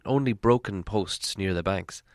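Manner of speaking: usual speaking voice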